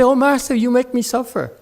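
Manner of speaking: high mocking complaint; a hint of mock whining; mock whine. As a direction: mimic the student's accusation. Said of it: "You say".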